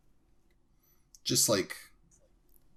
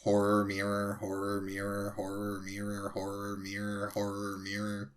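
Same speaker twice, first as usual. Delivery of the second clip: monotone loop, growing softer; repeated over and over; 5 dB quieter than usual. Shown as a demonstration. Asked to say horror mirror over and over, monotone repetition. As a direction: chant it evenly, fading away. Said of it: "Like, over and over".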